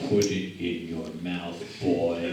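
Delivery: very low, teasing creepy voice; creepy innuendo. Acted. Teasing the speaker about the microphone, low creepy innuendo. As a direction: drop it low and suggestive.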